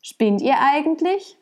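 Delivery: high-pitched indignant question; acted outburst at a mocking crowd; snapping at them. A narrator's reading. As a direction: round on them in storybook indignation. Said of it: "fuhr er sie an".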